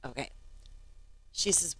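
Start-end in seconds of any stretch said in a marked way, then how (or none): none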